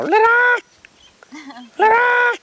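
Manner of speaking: very high drawn-out screech. Retold or imitated. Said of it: "you can hear many of them go".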